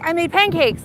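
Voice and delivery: lightly voiced eager Dimitri; mimicking Dimitri. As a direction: pipe it up like an eager kid.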